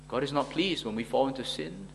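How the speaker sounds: ordinary talk, nothing marked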